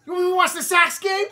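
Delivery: high, loud hammed-up Boston taunt, laughing; Boston accent, mock taunting. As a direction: yell it like a Red Sox fan, cracking up. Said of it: "I don't know how to do Boston".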